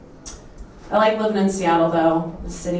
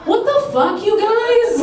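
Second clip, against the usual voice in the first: outraged disbelief; 13 semitones higher, slower than usual. Real, not acted. Outraged comic exclamation.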